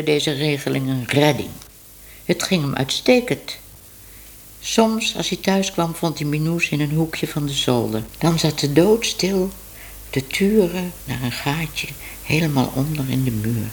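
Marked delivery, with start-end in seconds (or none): none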